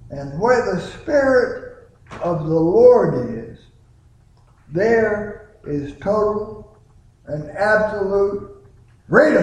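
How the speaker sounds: there is total and absolute freedom, slowed, with freedom shouted high; rising preacher climax; climactic emphasis. Acted. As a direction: build slowly and burst on freedom. Climax of the scripture quote.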